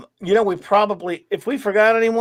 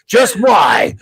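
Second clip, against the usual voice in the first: mock announcer; 5 semitones higher, 5 dB louder, much slower than usual. Mock show teaser announcement.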